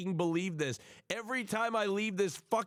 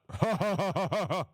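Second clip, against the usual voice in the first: put-on, pulsing belly laugh; Hassan laugh; 3 dB louder than usual. Streamer finishing a Hassan impression with mock laughter. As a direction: imitate a big rhythmic ha-ha-ha. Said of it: "This is my Hassan impression".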